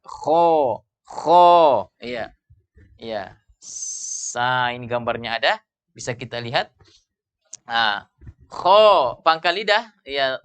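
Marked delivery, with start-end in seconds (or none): 0.1-1.9 KHO long, tongue back raised; thick kho. Shown as a demonstration. Teacher demonstrates isti'la, thick kho. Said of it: "pangkalnya terangkat". 8.5-9.2 loud, higher KHO rising into the palate; thick kho. Shown as a demonstration. Teacher models the letter from a diagram, thick kho. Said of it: "suara membumbung ke atas".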